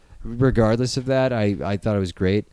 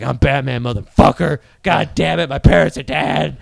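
mock Batman; 6 dB louder than usual. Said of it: "he'd never be like".

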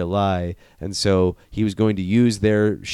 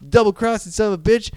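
much higher, loud outraged cry; betrayed outrage; 11 semitones higher, 4 dB louder than usual. Retold or imitated. McConaughey cursing his double-crossing partner, high outraged cry. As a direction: shriek it like you've been stabbed in the back.

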